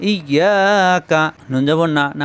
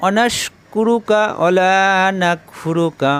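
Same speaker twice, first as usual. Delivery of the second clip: slow chant of the Arabic phrases; measured Quranic recitation; recited dua; slower than usual. Shown as a demonstration. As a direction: recite smoothly in the lesson's tajweed cadence. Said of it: "একই system".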